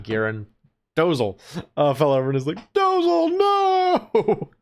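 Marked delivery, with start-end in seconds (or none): 2.7-4.5 high shouted "no!"; mock cry of alarm. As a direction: yell out the name in a high panic. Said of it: "everyone was like".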